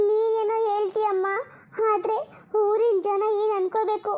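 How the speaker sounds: normal talking voice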